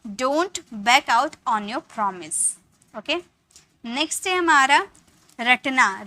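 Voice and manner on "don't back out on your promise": slow, even teacher read; slow classroom repetition; slow model sentence. Shown as a demonstration. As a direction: say it slowly once more. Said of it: "तो हम बोलेंगे".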